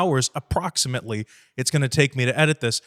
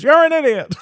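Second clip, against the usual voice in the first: high, loud mock Grinch jeer; Grinch insult; 11 semitones higher, 6 dB louder than usual. Grinch calling the viewer an idiot, high loud jeer. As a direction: sneer it like the Grinch. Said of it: "He goes".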